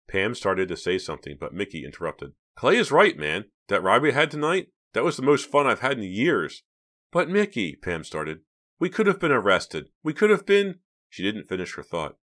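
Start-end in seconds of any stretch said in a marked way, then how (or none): 2.5-6.6 high, loud, animated; excited pitched-up enthusiasm; eager insistence. A narrator's reading. Boy eagerly interrupts to side with friend. 7.1-7.8 high, raised objection; worried, pitched-up protest. A narrator's reading. Girl protesting to her friend. 8.8-10.8 high, loud, climbing; worried, pitched-up objection; alarmed protest. A narrator's reading. Girl protesting, thought left unfinished.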